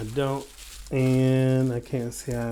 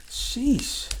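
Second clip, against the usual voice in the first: amazed sheesh; 12 semitones higher than usual. Real, not acted. Amazed by fidget toy.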